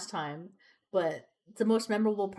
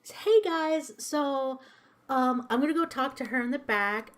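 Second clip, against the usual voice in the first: apologetic manager; 6 semitones higher than usual. Retold or imitated. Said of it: "and he's like".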